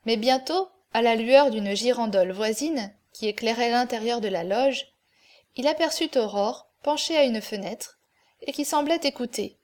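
usual speaking voice

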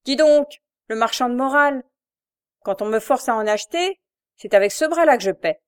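loud, threatening bully; menacing shout. A narrator's reading. Jacques threatening his crippled brother, loud menacing shout. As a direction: fists clenched, bark the threat. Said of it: "en criant".

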